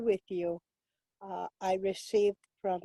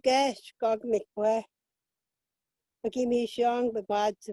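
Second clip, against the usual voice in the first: Ojibwe prayer. Acted.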